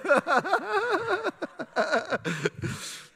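high, drawn-out laughter; long high laugh. Real, not acted. Pastor laughing at Alexis pointing at Sharonda, high laughter. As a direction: laugh hard at the congregation.

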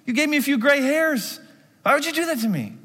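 high voiced Mary, tired and hurt; mother's voice. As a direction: plead as a worn-out mother. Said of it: "So she says".

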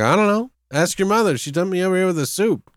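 clueless dad. Retold or imitated. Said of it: "He's like".

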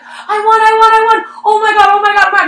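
high, loud giddy shouts of joy; retold excited shrieking. Retelling to friends how she ran down the hallway, excited shrieking. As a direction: squeal it like you just won big. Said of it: "I was so excited".

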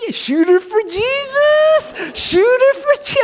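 very high, stretched-out cheer; Mark Anderson. Retold or imitated. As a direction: imitate an over-the-top courtside holler. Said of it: "and he's like".

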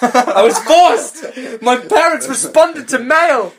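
high, slow mock outrage; mock melodrama. Mocking the teen narrator, hammed-up outrage.

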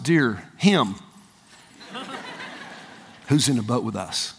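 low, soft mock amazement; hushed awe. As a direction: drop to a stunned hush. Acts awed disciples after storm calms.

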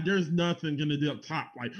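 ordinary delivery

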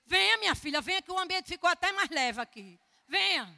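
high, loud, insistent invitation; eager welcome. Retold or imitated. Owner welcoming the missionary back, eager. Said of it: "Já recebeu com alegria".

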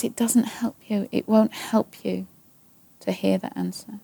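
soft, calm and patient reply; gentle voice of God. Retold or imitated. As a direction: speak it gently, like a loving father. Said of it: "He says".

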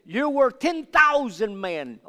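slow, loud, high proclamation; acted emphatic plea. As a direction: call it out slowly as the people honouring their king. Acting out the people's plea to David.

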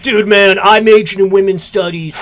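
loud, shouting dude bro; gym bro. Retold or imitated. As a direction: bellow it like a frat bro. Mock bro continuing the imagined critic.

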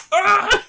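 very high yelp of effort; sharp cry. Retold or imitated. Hero waking himself from the dream, very high sharp yelp. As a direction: cry out as he cuts through. Slitting his throat to wake.

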